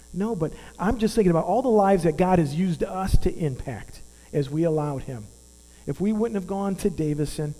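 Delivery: ordinary talk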